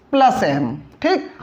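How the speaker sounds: ordinary delivery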